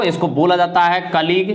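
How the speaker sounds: ordinary delivery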